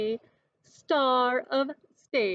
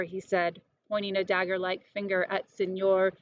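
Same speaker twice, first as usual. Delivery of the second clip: usual speaking voice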